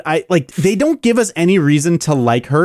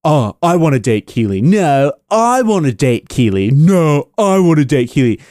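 lower, dithering mock voice flipping back and forth; lightly mocking, lower-voiced indecisive lover; mocking dithering characters; 3 semitones lower than usual. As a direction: play the indecisive lovesick guys, mocking. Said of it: "you know".

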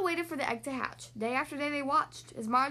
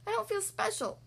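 higher-pitched, downcast cow; down in the dumps; 8 semitones higher than usual. A narrator's reading. Marjorie confides in the chickens, down in the dumps. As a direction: complain mildly, pitched up, feeling left out. Sad cow voiced in story.

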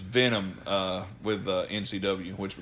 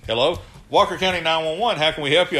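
higher, louder dispatcher voice; mock dispatcher; 7 semitones higher, 6 dB louder than usual. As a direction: play the 911 operator brightly. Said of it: "and I was like".

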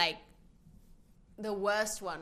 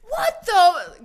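high, loud mock fury; imitated outburst; 12 semitones higher, 14 dB louder than usual. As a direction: explode like a mom behind closed doors. Mom blowing up at home.

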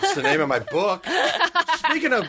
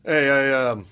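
low, grudging approach; reluctant dude with male ego; 6 semitones lower than usual. Retold or imitated. Dude approaching for his girlfriend, grudging and low. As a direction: drop low and play too cool to care. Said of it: "it's a male ego".

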